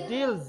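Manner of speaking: ডিলস with the final s said as a z; ডিলস ending in a clear buzzing z; z sound on s. Shown as a demonstration. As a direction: end ডিলস on a voiced z. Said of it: "হবে".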